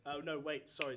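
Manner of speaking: normal talking voice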